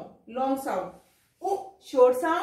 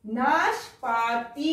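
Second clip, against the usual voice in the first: drawn out slowly, sound by sound; slow syllable-by-syllable reading; 2 semitones lower, much slower than usual. Shown as a demonstration. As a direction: sound out नाशपाती for the class. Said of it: "teacher sounds out word".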